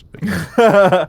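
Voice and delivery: laughing. Real, not acted. Laughing at own joke.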